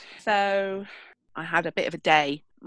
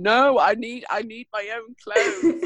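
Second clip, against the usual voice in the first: whiny son; 5 semitones higher, 3 dB louder, slower than usual. Retold or imitated. Son refusing bribe.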